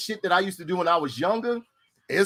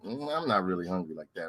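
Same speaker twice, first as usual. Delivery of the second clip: low, softer hesitant decline; low soft mock refusal; polite refusal; 9 semitones lower, 9 dB quieter than usual. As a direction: brush it off quietly. Declining Fred's food.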